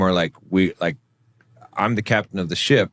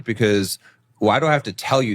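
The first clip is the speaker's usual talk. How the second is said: ordinary talk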